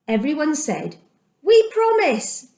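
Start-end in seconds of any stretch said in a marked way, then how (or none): 1.4-2.5 high, bright promise from the people; eager crowd reply. Acted. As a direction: answer up high like a keen crowd. The people answer Joshua.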